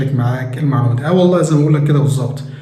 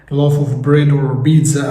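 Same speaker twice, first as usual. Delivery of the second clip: slow dictation; much slower than usual. Shown as a demonstration. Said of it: "teacher slow English phrase".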